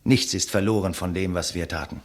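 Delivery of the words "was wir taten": ordinary talk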